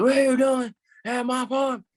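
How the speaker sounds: high-pitched mock yell; imitated farmer yelling. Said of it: "he started yelling, like".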